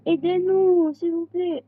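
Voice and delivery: high, loud plea for help; begging for rescue; calling for help. Acted.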